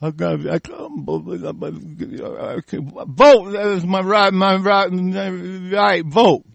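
mock stammering conservative voter. Host apes conservatives chanting about their right, mocking stammer. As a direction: stumble over the words like a dim supporter. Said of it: "You conservatives on board".

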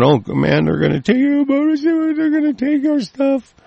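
sneering whiny caricature of gun owners; mocking whiny voice. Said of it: "pissing and moaning around".

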